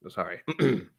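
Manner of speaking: ordinary talk, nothing marked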